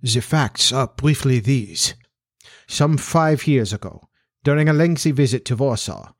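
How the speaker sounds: put-on formal Central European-accented monarch; measured, accented aristocratic explanation; Bohemian king. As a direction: confide it as a proud foreign king. Voices the king as a character.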